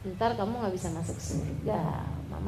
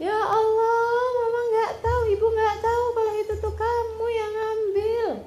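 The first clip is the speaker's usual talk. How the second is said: much higher, louder crying voice; tearful mother; 11 semitones higher, 4 dB louder than usual. Retold or imitated. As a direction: wail it like a heartbroken mother. Mother learns son took money.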